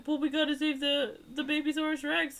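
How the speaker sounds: high-pitched mock-earnest rescue plea; put-on high voice for a retold character; mock-earnest hero. Retold movie hero pleading for baby.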